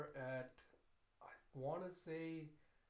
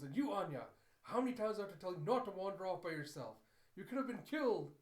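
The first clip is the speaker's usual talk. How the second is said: loud, raised scolding; angry shouting; 5 semitones higher, 7 dB louder, faster than usual. Acted. Father scolds daughter for wandering off, angry shouting. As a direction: shout it like a furious worried parent. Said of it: "gets mad and shouts for her".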